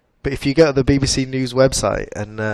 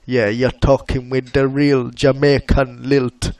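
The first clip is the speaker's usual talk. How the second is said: slow, louder imitation of a cringey Jamaican accent; a hint of a put-on Jamaican accent, drawn out; mock Jamaican accent; 2 semitones higher, 6 dB louder, slower than usual. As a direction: lightly mimic a presenter's bad Jamaican lilt. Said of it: "Richard had to do his Jamaican accent".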